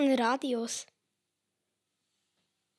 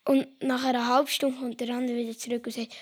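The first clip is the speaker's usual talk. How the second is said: ordinary delivery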